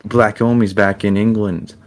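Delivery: light London roadman voice; lightly put-on British slang accent. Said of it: "Yeah, Roadman style".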